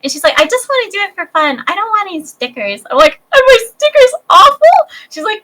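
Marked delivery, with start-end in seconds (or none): 0.4-2.8 much higher, sweet put-on little girl. 3.3-4.8 much higher and louder, hammed-up wounded squeal; mock hurt.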